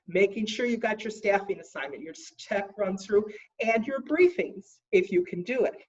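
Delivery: ordinary talk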